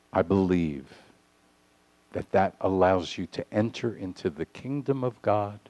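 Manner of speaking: ordinary delivery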